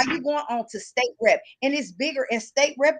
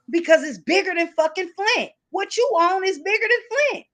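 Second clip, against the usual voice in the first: raised emphatic voice; 6 semitones higher than usual. Real, not acted. Host pressing Rich that his stakes are bigger than Flint, raised emphatic voice. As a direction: drive it home with rising force.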